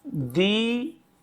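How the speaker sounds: Deepawali slowly, in separate pieces दी, पा, वली; syllable by syllable. Shown as a demonstration. Teacher breaking down the word for students, Deepawali piece by piece. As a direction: stretch दी and पा of Deepawali with gaps.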